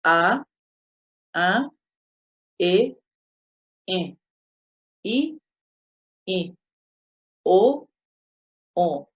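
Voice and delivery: cada vocal dos veces, primero oral y luego nasal; vocales orales y nasales. Shown as a demonstration. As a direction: pronunciar "A, a" como oral y luego nasal, par por par. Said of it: "ha umíva ha'e". (vocal oral seguida de su nasal, clara y separada)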